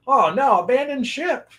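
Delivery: high mock panic, loud; panicked goon. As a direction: squeal it like a fleeing henchman. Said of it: "are like".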